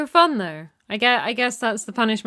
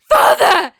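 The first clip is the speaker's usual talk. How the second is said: screaming the word, much higher and louder; desperate yell to a fallen father; anguished shout; 13 semitones higher, 9 dB louder than usual. Acted. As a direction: cry out for him in grief. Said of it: "A little bit loud".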